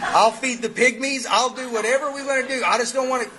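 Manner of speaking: high-pitched, hammed-up begging; mock pleading prayer. Sponsor offering God anything to get out of it, mock pleading. Desperate bargaining prayer acted in story.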